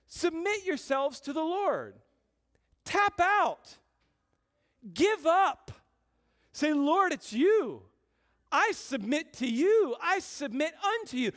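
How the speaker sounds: high, loud exhortation with pauses; forceful preaching. Real, not acted. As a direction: preach each command out to the whole room. Preaches the command at full force.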